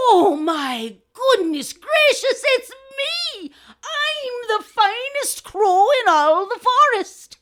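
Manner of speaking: slow, high, loud crowing wonder; amazed crow. Acted. Last crow discovering he is finest, amazed. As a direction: stretch each word in gleeful amazement.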